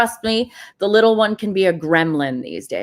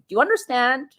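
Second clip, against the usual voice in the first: ordinary talk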